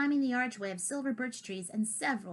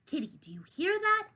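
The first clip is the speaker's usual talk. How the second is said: put-on young cat's voice; 9 semitones higher than usual. Character voice in read story.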